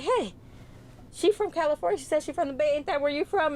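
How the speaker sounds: high-pitched, eager coworker call-over; imitated coworker. Coworker pulls another coworker over to ask, imitated high-pitched call-over. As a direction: mimic a coworker pitching it up to put a question.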